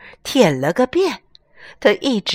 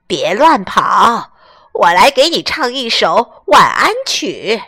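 slow hoarse louder duck lady; 3 dB louder than usual. Acted. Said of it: "她用沙哑的嗓音说".

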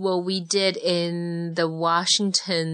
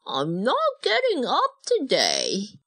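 muffled voice; 6 semitones higher than usual. Acted. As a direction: voice muffled under bedclothes. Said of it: "his muffled voice told his mom".

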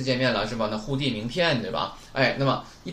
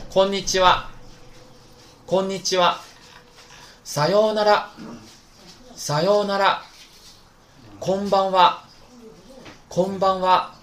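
loud, higher call-and-repeat reading; read-along drill; 5 semitones higher, 5 dB louder than usual. Shown as a demonstration. Teacher leads the class through the phrase drill, loud and clear. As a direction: read each phrase twice for the class to echo. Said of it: "一起来读一下".

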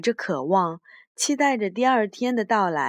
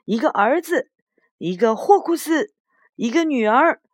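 higher, louder quarrelling cries; moderately raised, insistent shouts; acted howling; 6 semitones higher, 5 dB louder than usual. A narrator's reading. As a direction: yell back in a storybook squabble. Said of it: "霍库斯嚎叫起来".

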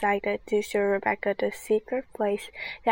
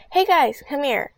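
loud shouted call; 5 semitones higher, 8 dB louder than usual. Acted. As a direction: call friends over. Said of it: "Andy sh-, called us".

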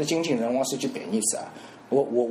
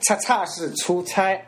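慢慢强调"出差"; 把"出差"放慢纠正; 纠正发音; 6 semitones higher, slower than usual. Shown as a demonstration. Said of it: "不是车子太多". (放慢纠正"出差"的读音)

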